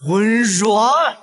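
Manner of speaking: high-pitched exasperated father's shout; scolding outburst, pitch leaping up; angry yell. Acted. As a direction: yell for the child, then demand the duster. Father yells at misbehaving child.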